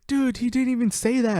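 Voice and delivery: high-pitched protest from a duped friend; mock indignant protest. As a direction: imitate someone crying foul.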